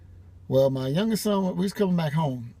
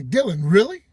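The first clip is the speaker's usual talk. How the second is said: louder, higher incredulous question; retold exasperation; 5 semitones higher, 7 dB louder than usual. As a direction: imitate a dad in exasperated disbelief. Retold exasperated remark to fleeing son.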